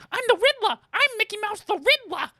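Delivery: put-on very high mouse voice with an odd accent; Mickey Mouse. Mimicking the co-host's failed impression, accented mouse voice. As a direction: squeak it in a muddled accent. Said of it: "Mickey Mouse with, with whatever accent".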